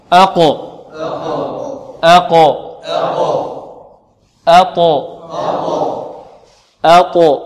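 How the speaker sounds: each letter ended with an echoing bounce; qalqala drill. Shown as a demonstration. Teacher drilling the bouncing letters for students. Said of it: "نطبق الآن".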